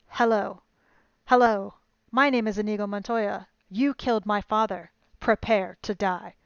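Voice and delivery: loud and forceful all through; strong, loud. A narrator's reading. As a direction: declare it with full strength. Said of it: "Stronger now".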